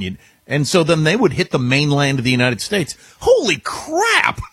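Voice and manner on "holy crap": high, drawn-out outburst of alarm; astonished, stretched-out exclamation; stunned exclamation. Real, not acted. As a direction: cry it out slowly, way up in pitch. Alarmed at escalation to war.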